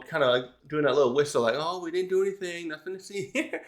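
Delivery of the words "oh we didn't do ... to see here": soft, mock-innocent, half laughing; innocent whisper. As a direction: play dumb, hushed and giggly. Crew whistling innocently.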